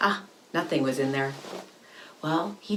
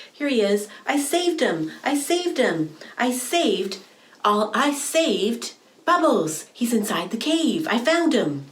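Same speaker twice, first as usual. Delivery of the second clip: higher and louder, triumphant; proud excited; 6 semitones higher, 4 dB louder than usual. Acted. Octopus telling the mom he saved Bubbles, triumphant. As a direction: crow about the rescue.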